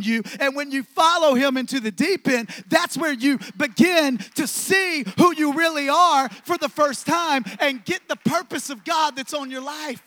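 fired-up sermon climax; full-force preaching. Acted. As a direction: build it up and preach it out.

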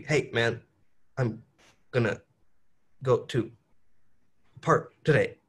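choppy, words split by pauses; staccato. Shown as a demonstration. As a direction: chop each word apart. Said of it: "But staccato would be".

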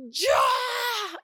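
high, drawn-out exploding yell; acted scream of exasperation; vociferating. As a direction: burst out as if control just snapped. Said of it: "Y vociferas".

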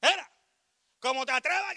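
high, loud mock outrage; imitated angry uncle. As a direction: scold the kid like an angry uncle. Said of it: "venían". (tío enojado imitado, agudo y fuerte)

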